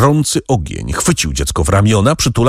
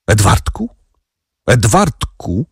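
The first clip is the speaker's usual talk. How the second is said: slow, strangled voice from a tight throat; choked out. A narrator's reading. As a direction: force the name out through a closed throat. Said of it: "wykrztusił". (wydobyte z trudem, ze ściśniętego gardła)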